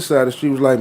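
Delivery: normal talking voice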